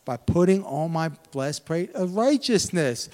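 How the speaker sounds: usual speaking voice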